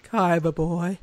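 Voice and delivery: a lighter echo of the seductive villain; Pegasus voice. Retold or imitated. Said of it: "I think I can do Pegasus".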